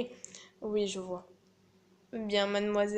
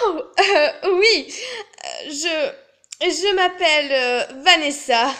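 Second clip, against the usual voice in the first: haute, forte, pleine d'hésitations; présentation embarrassée; 5 semitones higher, 8 dB louder, slower than usual. Acted. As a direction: bafouiller en jouant l'admiratrice. Se présente sous un faux rôle. (présentation troublée et exagérée)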